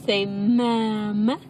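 slow, stretched-out word with rising end; coaxing slowly. Shown as a demonstration. Parent teaching baby to say Mama, slow coaxing. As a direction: draw out Mama and lift the end.

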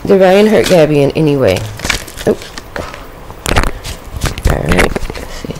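normal talking voice

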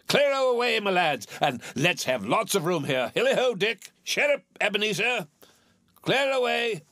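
high-pitched, hearty commands; rally the lads merrily across the room; jovial calling out. A narrator's reading. Fezziwig calls to clear the room.

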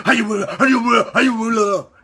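high, loud hollered chant; mock play-acting gibberish. Children playing make-believe back in Mexico, hammed-up hollering. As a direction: whoop it like kids at play. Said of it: "that's how we talked Indian".

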